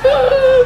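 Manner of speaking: very high, loud mock whimper; muffled sob. Child ordered silent, high muffled mock cry. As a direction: squeeze out a hummed fake sob. Child stifling sobs after warning.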